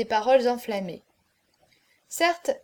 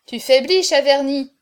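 loud call to a flagging drinker; raised, exclaimed jibe; cried taunt; 2 semitones higher, 8 dB louder than usual. A narrator's reading. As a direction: cry out that he is failing. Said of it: "s'écria Auriol".

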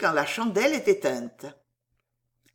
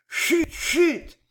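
hushing; 9 semitones higher than usual. A narrator's reading. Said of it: "tout le monde se prit à dire".

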